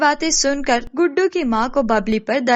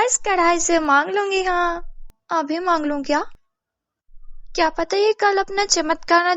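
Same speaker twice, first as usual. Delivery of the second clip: higher, eager schemer's voice; greedy, delighted neighbour; 6 semitones higher than usual. Acted. Babli demands silver from kadhai, high greedy voice. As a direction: marvel and plot with rising greed.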